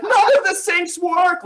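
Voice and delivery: high, loud, slow mock panic. Playing a forgetful wife panicking in IKEA, mock panic. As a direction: ham up a frantic homemaker.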